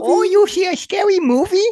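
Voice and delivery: put-on shrieking old lady voice. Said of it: "in his old lady voice".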